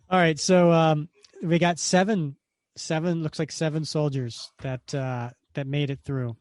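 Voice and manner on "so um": usual speaking voice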